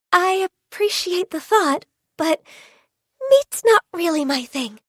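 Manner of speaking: reluctant, courteous no-thanks; polite refusal. Acted. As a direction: decline awkwardly, pausing around "but". Awkwardly declining food offered.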